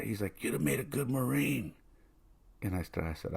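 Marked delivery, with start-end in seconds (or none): 0.4-1.7 high, thin imitation of a grandfather; frail proud grandfather. Ailing grandfather praises grandson, high frail voice. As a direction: imitate an old man praising you, high and nearly whispered.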